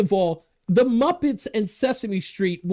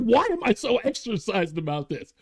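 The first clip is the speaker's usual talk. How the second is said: normal talking voice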